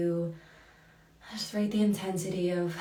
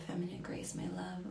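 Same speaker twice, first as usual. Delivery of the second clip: much softer, breathy whispered reading; 11 dB quieter than usual. Real, not acted. Host reads a viewer's loving message aloud, whispering.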